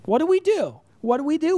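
rising urgency repeating the question; pressing question. Acted. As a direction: push the question at the crowd. Preacher repeats question louder.

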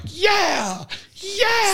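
very high, breathy-free squeal of agreement; mock swooning women. Mocking women fans agreeing, very high squeals. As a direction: squeal it like a fan. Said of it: "the women are like".

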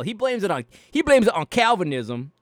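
loud, badly faked Ruslan KD accent; hammed-up Ruslan KD accent, punching blames. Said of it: "that was a terrible Ruslan K D accent".

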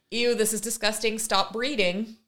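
ordinary talk, nothing marked

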